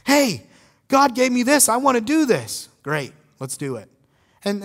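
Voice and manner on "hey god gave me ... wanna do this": bright, raised, eager offer; louder, higher eager voice; eager volunteer. Retold or imitated. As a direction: voice the keen church member stepping up. Congregant voiced asking to serve.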